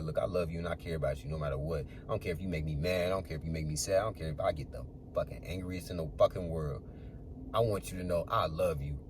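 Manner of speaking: reassuring father. Retold or imitated. Father telling his kids he loves them after yelling, soft and reassuring. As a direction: tell them gently you love them no matter what.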